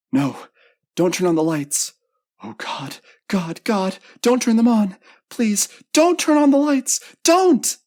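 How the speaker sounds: high panicked pleading building to a full scream; hysterical, loud, very high terror; shrieking. A narrator's reading. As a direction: beg desperately, climbing into shrieks. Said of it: "Louise was shrieking now".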